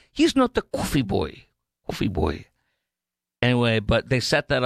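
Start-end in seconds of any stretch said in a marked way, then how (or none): 0.1-2.4 imitating a Greek accent. Host mimics the aide's wife defending him, in a Greek accent. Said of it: "She had, like, a Greek or foreign accent".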